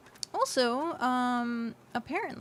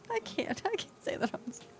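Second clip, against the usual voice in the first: laughing; 11 semitones higher than usual. Real, not acted. Shocked laughter at crude joke.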